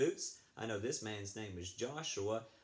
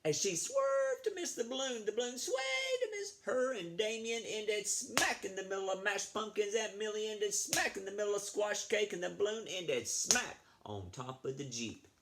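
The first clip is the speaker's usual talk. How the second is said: much higher, excited storytelling; dramatic climax reading; 10 semitones higher than usual. Acted. Reading the pumpkin crash to the kids, very excited. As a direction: sell the slapstick crash. Reading picture book to children.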